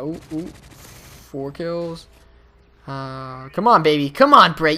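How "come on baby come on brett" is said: loud, urging himself on; root for yourself, pumped; excited cheering. Real, not acted.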